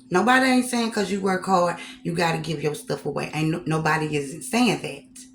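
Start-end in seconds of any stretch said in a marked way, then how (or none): none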